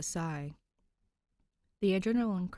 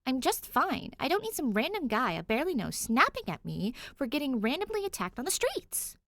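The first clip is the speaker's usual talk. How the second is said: high, loud, heated complaint; indignant rant; 8 semitones higher, 4 dB louder than usual. Acted. Danny snapping back at the cop, high and heated. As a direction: push him off, fuming.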